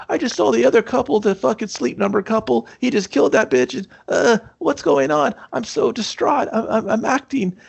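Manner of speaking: very high, fast, frantic mock panic; hammed-up distraught guy. Husband overacting shock to the director. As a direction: squeak it out like a bad actor faking distress. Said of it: "He's like".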